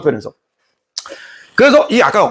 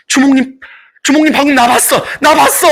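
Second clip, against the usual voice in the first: 높고 들뜬 환호성, 꺅꺅대듯; 반한 처녀처럼 들떠 외치기; 들뜬 처녀들; 9 semitones higher than usual. Acted. 처자들이 주몽에게 환호. (주몽에게 반한 처자들이 외치듯, 높이 들뜬 환호)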